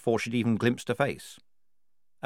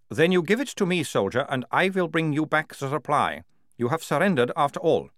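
lightly voiced captain, insistent and higher; commanding captain; 6 semitones higher, 4 dB louder than usual. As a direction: press the soldier with mild authority.

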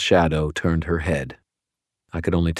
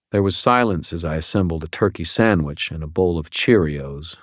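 ordinary delivery

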